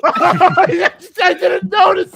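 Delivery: cracking up, barely getting words out; laughing hard. Real, not acted. Cracking up at the joke.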